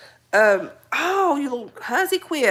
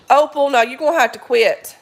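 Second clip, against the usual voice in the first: louder scolding; 3 semitones higher, 8 dB louder than usual. Real, not acted. Scolding dog Opal.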